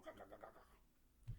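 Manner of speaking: slow, soft gobbling noises; low, slow chomping sounds; mock monster chomping. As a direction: play a monster gulping him down. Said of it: "I thought she was gonna eat me".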